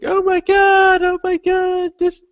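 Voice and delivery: hammed-up terrified peasant; scared villager. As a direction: squeal like a frightened villager staring at the woods. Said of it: "scared villager".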